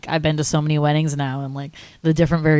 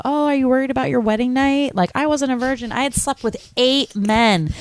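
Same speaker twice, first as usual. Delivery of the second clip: higher-pitched imitated granny voice; lightly acted granny confession; confessing old lady; 7 semitones higher than usual. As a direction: confide it as a little old lady. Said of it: "she confesses to him".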